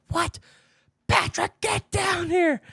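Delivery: high, slow call upstairs to Patrick; mom calling out. Retold or imitated. Mom calls her son down, high and drawn out. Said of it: "my mom was like".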